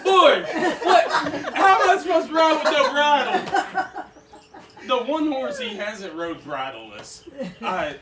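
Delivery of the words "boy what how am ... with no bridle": exasperated yell. Retold or imitated. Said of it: "I'm like".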